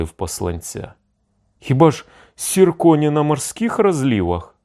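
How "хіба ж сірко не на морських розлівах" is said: high, eager query; suddenly revived question. A narrator's reading. Doomed envoys questioning the messenger, suddenly lively and high. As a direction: ask with a rush of new hope. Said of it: "враз ожили і спитали".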